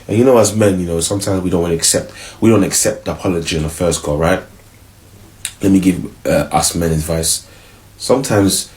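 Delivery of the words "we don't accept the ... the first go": usual speaking voice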